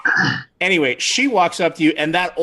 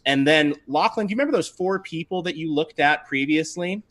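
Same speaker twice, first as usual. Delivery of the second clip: normal talking voice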